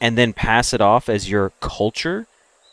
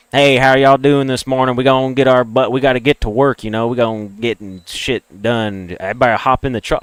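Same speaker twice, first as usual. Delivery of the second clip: put-on Southern accent. Imitating hometown friends coming in for work, Southern accent. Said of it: "you don't have a Southern accent".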